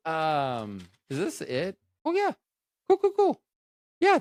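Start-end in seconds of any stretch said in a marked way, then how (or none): 2.0-4.2 very high squeaky repeat; squeaky cool. Acted. Excited reaction to notes.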